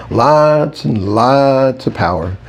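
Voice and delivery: slow, drawn-out relish; happy. A narrator's reading. Narrator telling Red about spare power, happy. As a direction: stretch the words out gleefully. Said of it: "I said happily".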